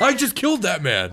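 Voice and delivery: high, loud, gleeful boasting; triumphant boast. Pretend. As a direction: crow about the kill. S0 plays elf boasting kill.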